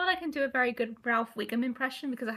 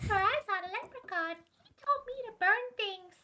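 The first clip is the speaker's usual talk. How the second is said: put-on high, childlike Ralph Wiggum voice, way up in pitch; Ralph Wiggum impression; 10 semitones higher than usual. Streamer shows off the impression friends praised, high and childlike. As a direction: say it like a dim, sweet little boy. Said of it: "Oh, there's Ralph".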